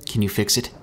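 ordinary delivery, nothing marked

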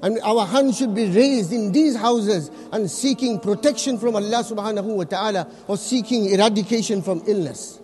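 normal talking voice

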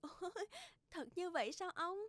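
put-on high, bashful young woman's voice; ngượng ngùng. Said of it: "ngượng ngùng hỏi lại". (giọng cô gái cao, ngượng ngùng)

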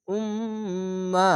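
Umma chanted with held nasal; tajweed-style Umma; slow recitation. Shown as a demonstration. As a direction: intone Umma slowly. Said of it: "এখানে দুই পেশ আছে".

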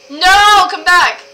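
loud call after a friend, high; desperate shout after a friend. Real, not acted. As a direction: shout to stop them leaving. Friend walks off, calling after.

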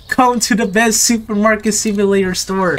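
mild imitation of his own sales call; retold shout-out. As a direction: call it out, toned down. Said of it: "shouted out everybody saying".

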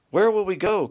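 put-on young swans crying out a worried question; cried. A narrator's reading. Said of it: "cried all the cygnets".

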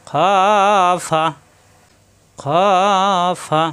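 long rounded "খ" from the throat, short "ফা"; heavy throat kha. Shown as a demonstration. Teacher drills throat letter, drawn-out "খ" then "ফা". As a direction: draw out "খ" from the throat before "ফা". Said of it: "খ কণ্ঠনালী শেষ অংশ থেকে".

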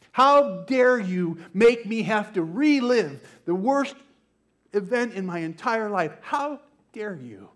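loud, hurt, accusing cry; a retold victim's loud accusing outburst; angry outburst. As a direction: fling the words back at her in fury. Victim confronting the prosecutor.

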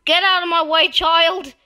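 loud, high yelled order; impatient shout. Real, not acted. Player ordering a child out of the way, impatient shout. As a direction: shout them out of the path.

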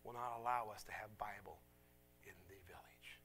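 soft, low, halting retelling; hushed imitated plea, fading nearly to whisper; understated tearful plea. Village woman begging for God's Word, quietly tearful. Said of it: "As tears are falling down her face".

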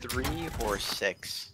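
slow, spelled-out repeat. Shown as a demonstration. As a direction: say it again slowly for clarity. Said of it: "Am I doing what?".

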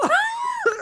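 high shrieking laugh; hysterical laugh. Real, not acted. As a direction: burst out laughing. Laughing hard at desk.

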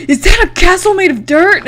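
high, loud incredulous question; retold shock, pitched right up and punched out; mock disbelief.

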